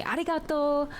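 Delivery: high, light Japanese-style arigato; imitating Japanese. Streamer quoting a Japanese phrase in Japanese style. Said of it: "like when he would say".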